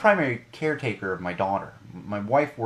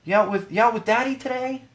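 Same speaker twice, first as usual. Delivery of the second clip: high, syrupy mock old lady; sweet cashier voice; 9 semitones higher than usual. Imitating the cashier cooing at the daughter, high and sugary. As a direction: coo at the toddler like a doting grandma. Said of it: "she looks up and she goes".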